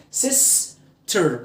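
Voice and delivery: سيستر split slowly as "sis... ter"; slow syllable-by-syllable سيستر; two syllables. Shown as a demonstration. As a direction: pause between the halves of سيستر. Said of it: "مقطعين".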